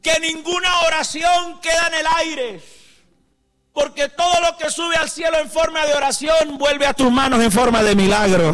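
loud, high-pitched preaching shout; preaching at full force. Acted. Preacher proclaiming to the congregation, full-force shouting sermon.

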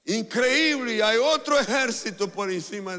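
imitar al criado maravillado, agudo y fuerte; grito de asombro. Retold or imitated. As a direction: anunciarlo a gritos, dramatizando el asombro. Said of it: "se asombró y regresó gritando". (grito asombrado actuado)

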